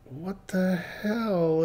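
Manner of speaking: high, soft, drawn-out disbelief; bewildered aside. Retold or imitated. Driver to himself as passenger bawls, bewildered. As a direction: stretch it out softly, baffled, to yourself. Reacting to passenger suddenly bawling.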